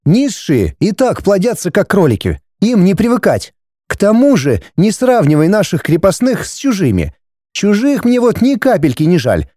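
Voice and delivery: high-pitched, haughty brush-off; dismissive wife. Acted. Wife telling husband to pay up, dismissive. Wife's figure in dialogue.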